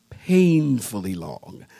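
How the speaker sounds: slow, dragged-out 'painfully long'; drawn-out emphasis. Real, not acted. Pastor describing three Sunday services, drawn out. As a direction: stretch PAINFULLY out slowly.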